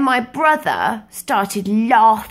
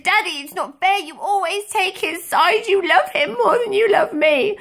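much higher, near-tearful protest; upset wail; 13 semitones higher, 4 dB louder than usual. Retold or imitated. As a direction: cry out that it's unfair. Child protesting to daddy, upset.

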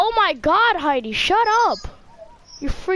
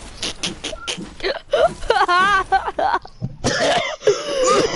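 hysterical laughing fit; 9 semitones higher than usual. Real, not acted.

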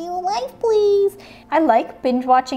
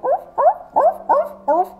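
high squeaky "oof, oof"; high oof sounds; 12 semitones higher than usual. Pretend. Voicing the hopping walrus toy, high oofs.

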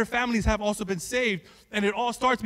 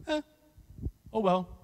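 unbothered shrug; 5 dB quieter than usual. Acted. Paul shrugging off his opponents.

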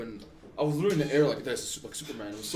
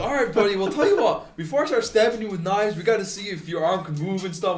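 high, loud, jolly doctor voice; silly doctor; 6 semitones higher, 5 dB louder than usual. Retold or imitated. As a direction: imitate an overly cheerful doctor addressing a patient. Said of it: "really silly".

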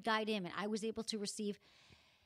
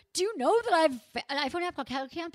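excited; 8 semitones higher, 9 dB louder than usual. Retold or imitated. Excitedly retelling her own bragging.

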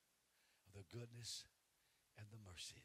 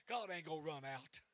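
"God ain't gonna run out" called out louder with weight on God; loud declaration; 5 semitones higher, 11 dB louder than usual. Real, not acted. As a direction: proclaim it, hitting "God". Preacher repeats line loud then soft.

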